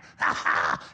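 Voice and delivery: low evil cartoon cackle; villain laugh. Pundit's Cobra Commander laughing after threatening doom. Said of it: "Or like Cobra Commander".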